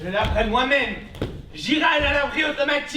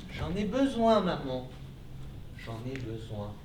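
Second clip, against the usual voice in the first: soft coaxing; 9 semitones lower, 7 dB quieter than usual. Acted. Son soothes frightened mother.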